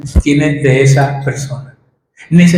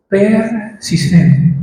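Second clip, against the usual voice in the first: Persistente stretched very slowly, syllable by syllable; drawn-out answer; 3 semitones higher, much slower than usual. Real, not acted. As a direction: let Persistente hang slowly in the air. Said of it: "Pero la oración debe ser, ¿qué?".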